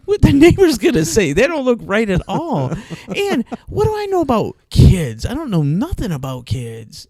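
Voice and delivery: high, loud, laughing outrage; scandalized. Retold or imitated. Worrying what neighbors say, high laughing outrage. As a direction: throw up your hands, scandalized.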